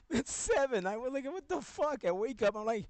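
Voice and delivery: high, loud laughing telling; still laughing. Real, not acted. Telling a friend about the scratched face, laughing. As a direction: keep giggling through the story.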